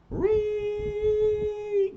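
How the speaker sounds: very high, piercing siren imitation; siren wail. Narrator imitating police sirens heading to the dock, high siren wail. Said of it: "Go the sirens".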